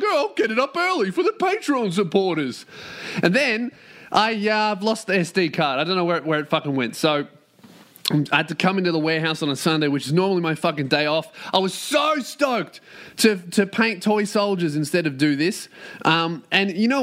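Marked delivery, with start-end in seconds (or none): 0.0-2.7 high-pitched, silly self-quote; mock your own earlier enthusiasm, voice pitched way up; mock eager self. Podcaster quoting himself planning an early upload, mock eager. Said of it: "and I was like". 11.5-12.8 long, high stretch on "so stoked"; emphatic. Real, not acted. Host lamenting his lost day off, emphatic. Disappointed about losing day off.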